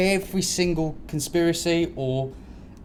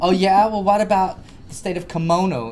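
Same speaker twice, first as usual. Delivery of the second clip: lightly raised, sassy retort; mock sarcastic girl; 6 semitones higher, 6 dB louder than usual. Daughter in a film mocking her dad's claims, mild sarcastic retort. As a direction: fire back with light sarcasm. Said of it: "the girl's just like".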